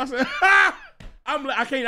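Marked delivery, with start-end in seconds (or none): 0.3-0.8 sudden high, loud "ha!"; re-enacted laugh. Showing friends how he reacted, re-enacted laugh. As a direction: burst out with one mock guffaw. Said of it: "I started laughing".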